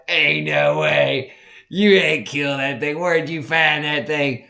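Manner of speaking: disbelieving shout. Retold or imitated. Young him doubting the hunter.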